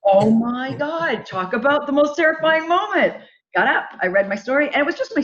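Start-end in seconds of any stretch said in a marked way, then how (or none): 0.0-3.2 drawn-out, high "Oh my God"; overwhelmed. Real, not acted. Recalling the judges panel, high drawn-out disbelief.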